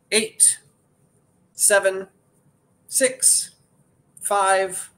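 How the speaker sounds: high, loud, spaced-out auctioneer count; counting down the last seconds of the auction, pitched high and excited; excited countdown. Real, not acted. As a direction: build the tension on each number, pitched high. Auction countdown to close bidding.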